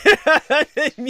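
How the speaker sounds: high, loud laugh breaking into the words. Real, not acted. Host cursing American males to co-hosts, laughing. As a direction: crack up mid-sentence. Cracks up after cursing them.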